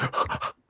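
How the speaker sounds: one quick unvoiced gasp for air; panting gasp. Shown as a demonstration. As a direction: gasp like a runner who has run out of breath. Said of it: "you all of a sudden start panting".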